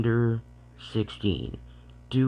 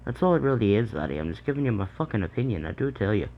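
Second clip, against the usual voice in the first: put-on Irish accent; 3 semitones higher, faster than usual. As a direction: lay on a stage Irish accent. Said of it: "we'll do it in the Irish voice".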